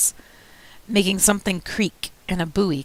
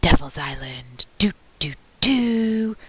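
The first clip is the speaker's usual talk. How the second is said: whispered spooky imitation; slower than usual. A narrator's reading. Boy alone copying his best friend's spooky line, whispering. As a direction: whisper it eerily like a radio thriller. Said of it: "I whisper, just like Pete".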